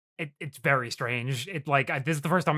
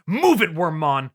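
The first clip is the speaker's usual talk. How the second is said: slow, louder bossy order; imitated mean command; 5 dB louder, slower than usual. Ken being mean to Wormmon, imitated harsh order. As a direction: shove him along with the voice. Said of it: "when he's being mean to Wormmon".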